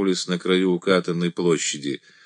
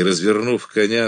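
ordinary delivery, nothing marked

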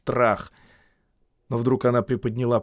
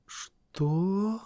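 startled; 10 semitones higher, 3 dB quieter than usual. A narrator's reading. Startled reply.

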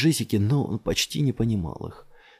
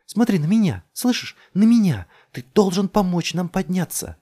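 high, loud, urgent commands; desperate shouting; 10 semitones higher, 7 dB louder than usual. A narrator's reading. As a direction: shout to break through his daze. Shaking man out of trance. (отчаянно, криком требует)

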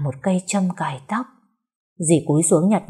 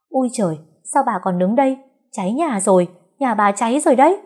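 higher, urgent neighbour's cry; panicked, pitched-up shout of warning; alarmed warning; 6 semitones higher, 3 dB louder than usual. Acted. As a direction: raise the alarm about a fire. Neighbour alerts them to a fire.

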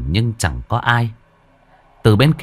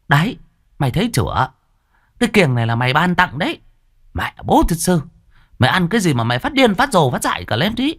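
berating mother; 9 semitones higher, faster than usual. A narrator's reading.

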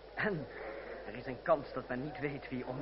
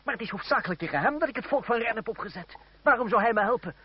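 higher and louder, incredulous protest; indignant outburst; 7 semitones higher, 11 dB louder than usual. Acted. Protesting to his cousin about the marquis, indignant. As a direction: fling the question back in disbelief.

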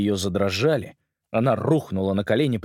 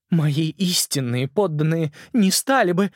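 lightly voiced uncertain queen; a queen admitting fault, unsure; hesitant queen voice; 8 semitones higher, slower than usual. Ant Queen protests.

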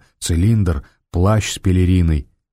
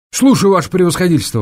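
eager orderly; 10 semitones higher, 3 dB louder, faster than usual. A narrator's reading. Orderly snapping to attention.

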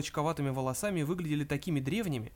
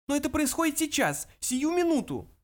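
high, loud, desperate insistence; frantic, raised-pitch outcry; urgent protest; 12 semitones higher, 4 dB louder than usual. Acted. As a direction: press the emergency on him. Zaphod pleads urgently during attack.

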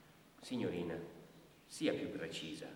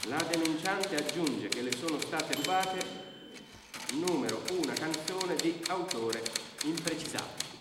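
higher and louder formal dictating; official dictation; 8 semitones higher, 7 dB louder than usual. Acted. Brigadier dictating the stolen song into the report. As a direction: announce it for the record.

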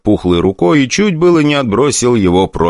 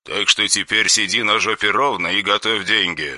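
soft, cold menace; quiet threatening caller on the phone; dry harsh voice; 7 dB quieter than usual. Acted. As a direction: threaten without raising the voice.

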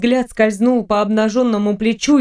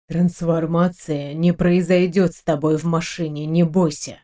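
lower-pitched, steady captain's voice; calm her fear in a low voice; low reassurance; 4 semitones lower than usual. A narrator's reading. (низким голосом, успокаивая)

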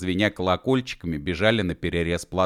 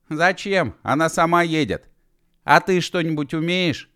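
high-pitched questioning call; 10 semitones higher, 4 dB louder than usual. A narrator's reading. Girl to the running men, high-pitched call. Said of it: "крикнула она Гидевану".

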